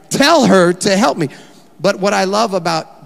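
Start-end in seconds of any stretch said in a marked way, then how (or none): 0.1-1.3 loud demanding order; bossy demand. Acted. Preacher repeating Martha's order to Jesus, loud. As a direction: bark it like a command.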